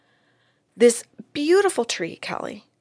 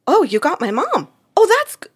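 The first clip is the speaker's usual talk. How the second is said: higher, louder burst of recognition; excited; 8 semitones higher, 7 dB louder than usual. Retold or imitated. Client thrilled to see her mom named, excited outburst. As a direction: blurt it out thrilled, then cut off. Client sees mom's name written.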